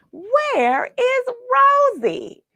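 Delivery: high, slow drawn-out call. Acted. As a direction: call across the ice for her. Calling out for lost Rosie.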